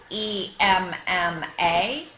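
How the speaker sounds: slow letter-by-letter E M M A; spelling out letters. Shown as a demonstration. Dog spelling a new friend's name, slow letters. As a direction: space each letter out. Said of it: "spelled their name".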